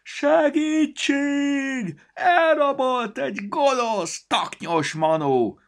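high, loud, slow squeaky yell; shouting again. A narrator's reading. Storybook shouting for help against the wicked elf, high and loud. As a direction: call for help at the top of its lungs. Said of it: "újra kiabálni kezdett".

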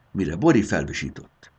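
usual speaking voice

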